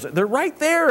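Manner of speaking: high-pitched mock insistence; imitated insistent son. Son to dad while deer hunting, imitated insistence. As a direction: act out an excited kid pointing something out.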